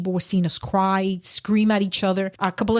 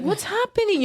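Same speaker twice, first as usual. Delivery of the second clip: imitating a child, much higher and bewildered; a confused boy asking what is going on; scared boy; 11 semitones higher than usual. Eleven-year-old son sees the scene.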